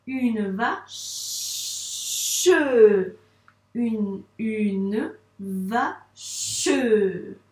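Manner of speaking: une, va, che split apart with a long shushed ch; syllable by syllable. Shown as a demonstration. Teacher assembling the word for a pupil, segmented syllables. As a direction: sound out UNE VA CHE in pieces. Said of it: "syllabe par syllabe".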